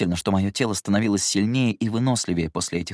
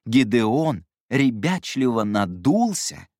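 slow, drawn out; 3 semitones higher, much slower than usual. Shown as a demonstration. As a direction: stretch out ребячливо надулся slowly. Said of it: "Нет, не так".

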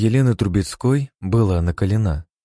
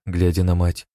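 ordinary delivery

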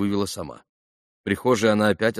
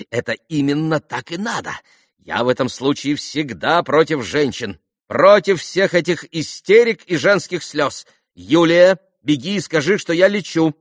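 high, emphatic, increasingly loud declarations; excitable society mother; 7 semitones higher, 6 dB louder than usual. A narrator's reading. Khokhlakova denounces hysterics and orders Julia off, high and excitable. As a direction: declare it grandly, then call out the order.

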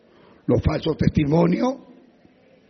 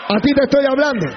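higher, louder and faster; louder repeat; 6 semitones higher, 6 dB louder, faster than usual. Acted. Pastor scolding an abusive husband, shouting faster. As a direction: hammer it home at him. Confronting the listener.

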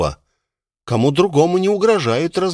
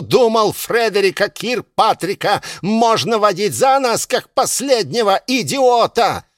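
higher, rising to a furious yell; enraged mayor; 7 semitones higher than usual. Acted. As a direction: tear into him in a rage. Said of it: "мэр вне себя".

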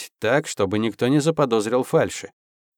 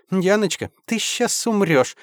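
put-on high, sing-song valley girl chirp; mannered chirping; 6 semitones higher than usual. A narrator's reading. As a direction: chirp it like a spoiled girlfriend. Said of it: "радостно защебетала".

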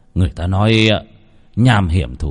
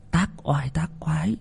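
fearful hushed whisper; 8 semitones higher, 8 dB quieter than usual. A narrator's reading. Mother telling of the fox spirit, in a fearful whisper. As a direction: whisper it as if the fox could hear.

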